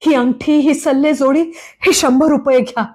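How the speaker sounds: buy your life with what you have; pleading. A narrator's reading. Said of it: "दिनवाणेपणाने ... विनवित होते".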